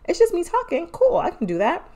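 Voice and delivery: high, light and carefree; breezy past self. Retold or imitated. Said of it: "I was like".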